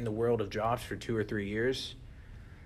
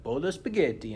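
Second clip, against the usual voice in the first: "Bowl of spaghetti" in a hammed-up Italian accent, pitched higher and louder; 5 semitones higher, 5 dB louder than usual. Shown as a demonstration. Telling a friend about a Zoom warm-up game, mock Italian accent. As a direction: toss out "Bowl of spaghetti" like a cartoon Italian cook. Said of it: "you had to make an Italian accent".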